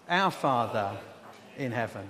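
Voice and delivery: loud, measured lead for a congregation; leading group recitation. Shown as a demonstration. Preacher leading the church in prayer, loud and deliberate. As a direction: say it out firmly for all to join. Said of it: "Let's just all say together".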